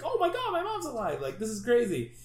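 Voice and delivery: high, loud, amazed disbelief; overjoyed Barry. Retold or imitated. Barry hugging his living mom, high amazed gush. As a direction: gush it like a son seeing his mom alive. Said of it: "he's like".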